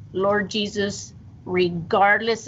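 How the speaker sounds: a slow, lifted call to Jesus; slow retold prayer. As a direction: plead upward, drawing each word out. Praying aloud over daughter.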